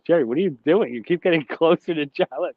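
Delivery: high-pitched imitation, breaking into laughter; mock a friend cracking up mid-question; retold laughing protest. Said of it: "started dying and laughing out loud".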